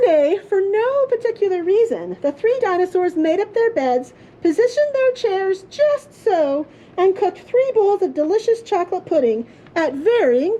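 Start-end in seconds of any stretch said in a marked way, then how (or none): none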